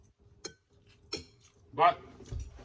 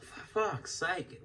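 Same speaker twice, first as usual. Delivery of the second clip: exasperated mutter; 3 semitones higher, 5 dB quieter, much slower than usual. Retold or imitated. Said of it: "I was like".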